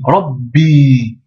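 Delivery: "Rabbi" blocked on the b, then a long i; doubled consonant. Shown as a demonstration. Teacher demonstrating the shedda, "Rabbi" with doubled b, long i. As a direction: stop on "Rab", then release a held "bi". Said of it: "Mais il y a une shedda".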